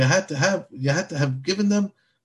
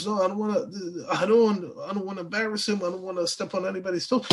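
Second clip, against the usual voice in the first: reluctant excuses; 5 semitones higher, faster than usual. Retold or imitated. Mimics Moshe's reluctant excuses.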